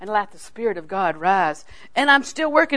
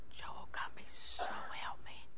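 put-on slow, menacing whisper to a child; angry whisper; 19 dB quieter, slower than usual. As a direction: whisper it slowly, holding the temper in. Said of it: "the lower I lowered my voice".

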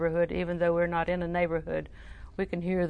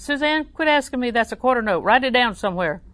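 higher, louder, fed-up telling-off; exasperated scolding; 7 semitones higher, 9 dB louder than usual. Retold or imitated. To Suzanne, who keeps asking, a loud fed-up scold. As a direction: snap at her to stop asking.

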